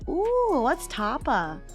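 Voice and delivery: ordinary talk, nothing marked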